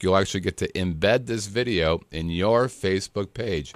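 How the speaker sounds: ordinary talk, nothing marked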